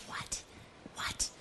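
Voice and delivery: hushed shocked what. Acted. King hears of cruelty.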